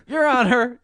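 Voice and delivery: high, loud Ross Perot impression. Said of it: "That's my Ross Perot voice".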